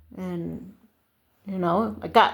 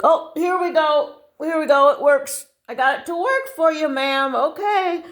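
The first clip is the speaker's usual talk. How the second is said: high, pleased announcement; acted chirpy store clerk; triumphant attendant; 5 semitones higher, 3 dB louder than usual. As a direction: proudly present the fix to a customer. Voicing the attendant's success.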